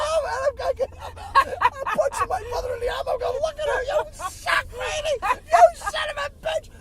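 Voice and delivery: high, loud heckling yell; fan yelling. Retold or imitated. Yelling at the TV in the game.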